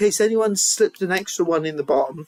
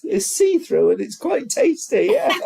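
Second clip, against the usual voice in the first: ordinary delivery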